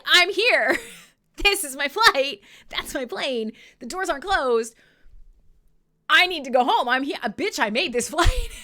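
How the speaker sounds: loud, frantic insistence at the gate; insistent protest. Retold or imitated. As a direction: argue your way onto the plane.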